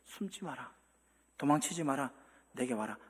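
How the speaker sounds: quiet, loving voice of God; gentle invitation. Pretend. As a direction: invite them in softly. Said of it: "책망이 아니라 초대예요".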